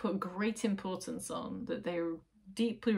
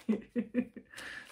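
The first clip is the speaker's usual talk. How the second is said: soft breathy laugh; 4 semitones higher, 4 dB quieter than usual. Real, not acted. Laughs at herself.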